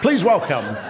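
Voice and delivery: high, excited mock show introduction; mock announcer. Host pretends the forgotten comedian is here tonight, mock announcer. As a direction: announce a surprise guest, hammed-up and high-pitched.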